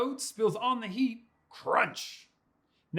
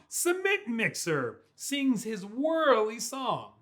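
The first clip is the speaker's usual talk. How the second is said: ordinary delivery, nothing marked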